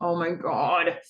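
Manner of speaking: frustrated. Retold or imitated. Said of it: "Cam's really frustrated with him".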